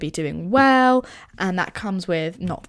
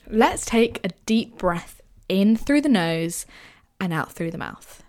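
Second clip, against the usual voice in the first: guided meditation. Acted. Leads a short guided meditation.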